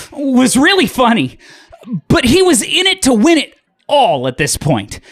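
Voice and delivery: ordinary talk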